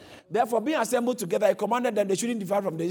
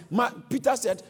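usual speaking voice

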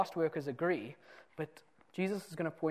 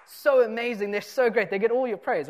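loud, high gushing praise; hammed-up cheering for the latecomer; mock gushing; 4 semitones higher, 9 dB louder than usual. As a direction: overdo the fawning admiration. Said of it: "suddenly everyone's like".

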